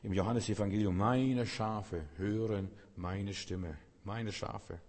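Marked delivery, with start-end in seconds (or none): 1.0-3.8 very slow and solemn, every word weighed; slow solemn quote, meine stretched; Jesus's words, drawn out. Acted. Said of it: "Und dann sagt Jesus". 4.0-4.8 much softer, almost to oneself; quiet tender repeat of Meine Schafe; soft echo. Acted. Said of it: "Und dann sagt Jesus".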